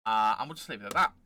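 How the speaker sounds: ordinary talk, nothing marked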